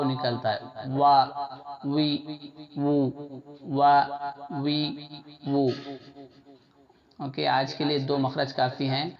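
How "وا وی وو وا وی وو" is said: وا وی وو with lips rounded, then opened; rounded lip syllables. Shown as a demonstration. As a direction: drill وا وی وو for the class. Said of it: "دونوں ہونٹوں کو گول کر کے الگ کرنے پر واو".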